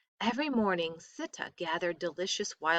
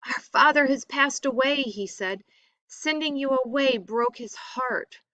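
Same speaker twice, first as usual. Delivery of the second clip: tearful; 6 semitones higher, 5 dB louder than usual. A narrator's reading. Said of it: "Tears streamed down his face".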